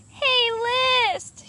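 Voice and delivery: high, loud call to the list; shouted. A narrator's reading. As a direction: shout after the flying list. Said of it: "shouted Kendall".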